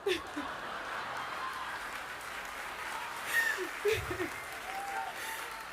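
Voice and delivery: airless, high laughing through tears; overwhelmed, breathless giggle. Real, not acted.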